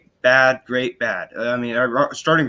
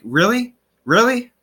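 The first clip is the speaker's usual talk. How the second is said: mock incredulous question, jumping higher on the repeat; 10 semitones higher, 4 dB louder, slower than usual. Teammates reacting to a pitcher's criticism, incredulous. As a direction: ask it twice in rising disbelief. Voicing the teammates' disbelief at Arrieta.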